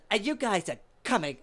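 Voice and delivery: flamboyant insistence. Retold or imitated. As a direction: insist grandly, as the showy host. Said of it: "so extravagant and flamboyant".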